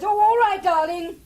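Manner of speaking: exasperated. Acted. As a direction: throw up your hands audibly. Ending frustrating phone call.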